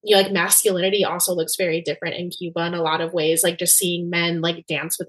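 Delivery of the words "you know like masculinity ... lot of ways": ordinary talk, nothing marked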